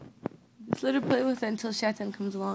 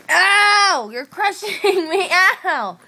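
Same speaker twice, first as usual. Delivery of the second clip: loud, high cries of pain; pained yelps; 7 semitones higher, 8 dB louder, much slower than usual. Real, not acted. Owner crushed by the dog, yelping loud and high.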